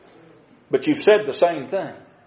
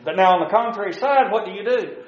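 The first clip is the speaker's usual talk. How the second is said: ordinary talk, nothing marked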